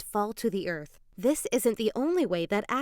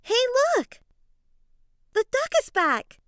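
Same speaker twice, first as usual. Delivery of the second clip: excited call; 11 semitones higher, 5 dB louder than usual. Acted. Spotting the lost duck again.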